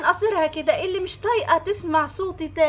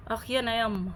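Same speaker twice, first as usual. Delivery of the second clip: ordinary delivery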